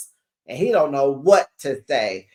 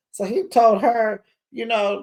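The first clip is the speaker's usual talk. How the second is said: ordinary talk, nothing marked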